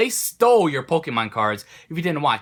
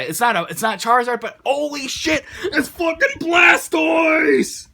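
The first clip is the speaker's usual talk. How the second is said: excited shout; 11 semitones higher, 4 dB louder than usual. Retold or imitated. Kid shouts over his Blastoise.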